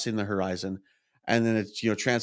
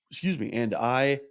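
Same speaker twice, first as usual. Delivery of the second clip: ordinary talk, nothing marked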